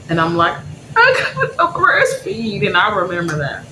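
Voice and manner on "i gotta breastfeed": high, drawn-out tearful whine; crying. Retold or imitated. Nursing mother turning down a drink, exaggerated crying. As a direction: re-enact a sobbing refusal, slow and squeaky. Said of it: "I remember crying because I couldn't drink".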